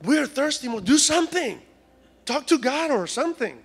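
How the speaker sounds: high, urgent mock pleading; mock complaining. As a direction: badger the leader in a hammed-up whine. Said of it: "but they complained".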